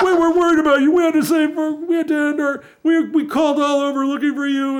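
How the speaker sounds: high, loud whiny mockery of the campers; mimicking the campers who beat him up, high and whiny; mock worried friends. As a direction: parrot their fake concern. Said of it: "I said".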